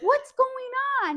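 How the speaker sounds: high, loud, drawn-out question; retold alarmed demand. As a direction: imitate dad demanding an explanation. Dad confronting the shouting son.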